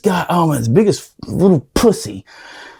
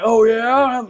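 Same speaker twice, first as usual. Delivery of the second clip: challenging instructor; 6 semitones higher than usual. Retold or imitated. Instructor challenging his excuse.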